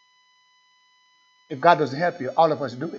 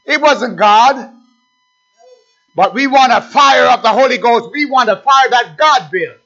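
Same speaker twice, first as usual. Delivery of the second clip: loud, higher, driving sermon voice; full-force preaching; 7 semitones higher, 8 dB louder, slower than usual. Real, not acted. Preacher confronting the congregation, full-force preaching.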